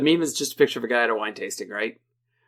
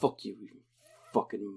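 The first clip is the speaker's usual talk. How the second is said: mock muttered rant, softer; imitating the rant; 3 semitones lower, 10 dB quieter than usual. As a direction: grumble it like his usual rant. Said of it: "the rant that he does".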